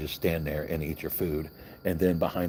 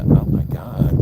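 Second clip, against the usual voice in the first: exasperated groan; 9 dB louder, slower than usual. Retold or imitated. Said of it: "And he's like".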